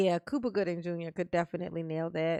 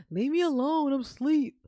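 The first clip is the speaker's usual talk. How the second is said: mock whiny teen, higher and louder; grumpy sleepy teen; 5 semitones higher, 7 dB louder than usual. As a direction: imitate a boy who won't get up. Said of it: "he was like".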